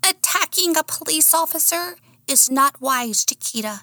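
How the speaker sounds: high-pitched character voice, warning; cautioning sidekick. A narrator's reading. Sidekick cautioning Chiquita about the officer, high-voiced. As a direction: warn in a high little voice.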